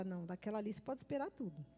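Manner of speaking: low, quick, soft aside; imitating someone's offhand verdict; knowing aside. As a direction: toss it off low and fast, as gossip. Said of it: "você fala".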